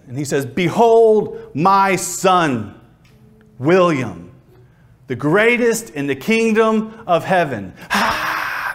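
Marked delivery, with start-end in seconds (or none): 0.4-7.7 grand, drawn-out proclamation; God proclaiming. Acted. God presents William to heaven's inhabitants, grand stretched-out proclamation. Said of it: "God turns William around to face all". 7.9-8.7 high, airy mock crowd cheer; crowd roar imitation. Preacher mimics heaven cheering William, high breathy crowd roar. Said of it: "the crowd goes wild".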